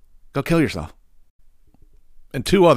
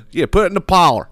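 imitating Kenzo relative; 6 semitones higher than usual.

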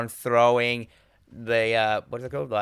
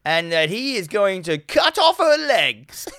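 squealing with laughter; laughing through it; 6 semitones higher, 4 dB louder, slower than usual. Real, not acted. Telling a friend the film's killer plot, laughing. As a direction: laugh out the gruesome twist. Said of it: "laughing while telling".

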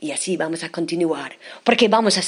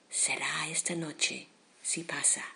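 whispering; 3 semitones lower, 16 dB quieter than usual. Acted. Poem line, hushed.

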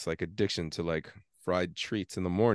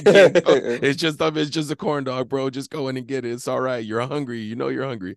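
coaxing himself, pitched higher; tempting inner voice; 5 semitones higher, 6 dB louder than usual. Retold or imitated. Hungry side of him coaxing, higher and light. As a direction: sweet-talk yourself into the corn dog. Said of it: "literally a mental battle".

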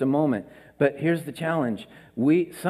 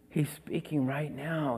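hushed; 3 semitones lower, 10 dB quieter than usual. Real, not acted. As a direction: bring the room down to a hush. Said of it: "He speaks in a gentle whisper".

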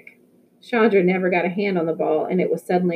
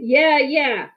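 higher, eager group yell; yelling back; 6 semitones higher than usual. A narrator's reading. The players answer Victor's call, eager yell. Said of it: "we all started yelling".

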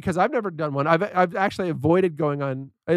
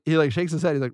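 ordinary talk

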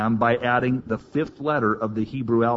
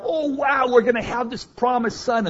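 much higher, giddy and fast; cracking up; 12 semitones higher than usual. Retold or imitated. Abraham laughing over the promised son, giddy high voice. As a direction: burst out in giddy joy. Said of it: "he's cracking up".

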